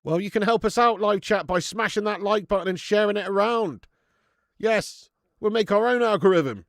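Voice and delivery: loud, high rallying call to viewers; streamer hype pitch. Real, not acted. Streamer asks live chat to like and share, loud hype pitch. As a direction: pump up the audience to pitch in.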